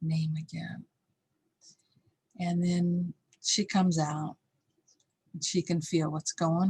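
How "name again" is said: menacing half-whisper; quiet retold threat. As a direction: imitate a father's hushed, stern warning. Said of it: "And it's really quiet".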